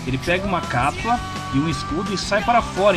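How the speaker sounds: usual speaking voice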